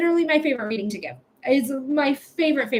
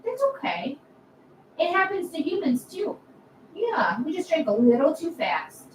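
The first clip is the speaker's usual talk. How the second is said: cooing to a cat; 4 semitones higher than usual. Real, not acted. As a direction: coo lovingly at the cat.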